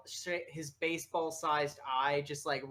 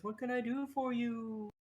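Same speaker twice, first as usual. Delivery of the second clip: higher-pitched little creature voice; lightly voiced eager little creature; creature character; 6 semitones higher than usual. Little creature offers help to an adventurer, higher creature voice. Game master plays Abel greeting player.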